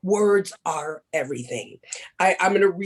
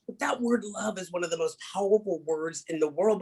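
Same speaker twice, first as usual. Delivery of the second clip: ordinary delivery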